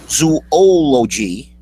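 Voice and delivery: loud, stretched "zoology" with a big OH after zoo; exaggerated pronunciation. Shown as a demonstration. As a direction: overdo "zoology", every vowel drawn out. Demonstrating ways to say zoology.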